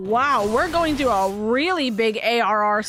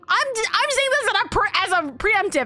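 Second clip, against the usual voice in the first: high, strained pleading; pitched up with real worry; real upset, voice pitched way up; 9 semitones higher than usual. Real, not acted. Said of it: "I'm gonna start crying".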